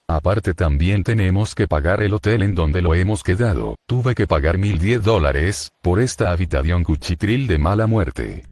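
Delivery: voz de otro personaje, mucho más grave; quejándose con voz grave de personaje. Acted. Otro personaje se queja de gastos. (personaje quejoso de voz grave)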